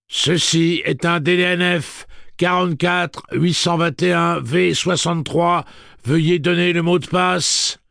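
loud, high, slow machine voice; robot announcement. A narrator's reading. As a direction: recite it like an automated system. Robot answers with serial number.

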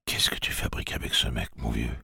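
unvoiced, soft thought; inner voice whisper. A narrator's reading. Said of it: "demanda mentalement Jake".

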